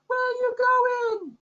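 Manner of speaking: very high, slow calling voice. Acted. Ship voice repeats its call to the fleeing player, very high and slow. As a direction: stretch it out, very high and plaintive.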